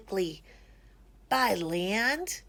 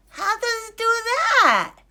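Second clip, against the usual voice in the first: higher, louder duck question; curious duck; 11 semitones higher, 7 dB louder than usual. A narrator's reading. Duck asks Ilyas how zakat helps, eager high question. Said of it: "asked Duck".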